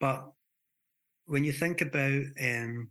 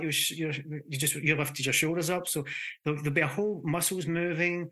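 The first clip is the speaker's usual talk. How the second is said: ordinary talk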